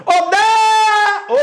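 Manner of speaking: high, sustained shout on "Dé"; calling out the syllable. Shown as a demonstration. Teacher showing what happens when shouting Débora, calling out. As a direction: holler the name's first syllable and hold it. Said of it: "quando você grita".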